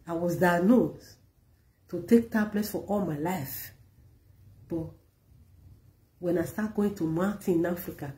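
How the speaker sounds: ordinary talk, nothing marked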